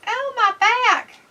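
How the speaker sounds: slow, high mock groan; mock back pain. Echoing co-host's aching-back joke, mock pained. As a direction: drag out a pretend aching complaint.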